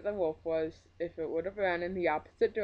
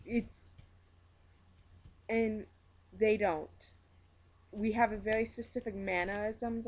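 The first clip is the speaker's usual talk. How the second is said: ordinary talk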